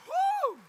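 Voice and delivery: high, loud "woo"; one big joyful cheer; celebratory whoop. Retold or imitated. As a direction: let out a piercing victory whoop. Celebrating getting the house.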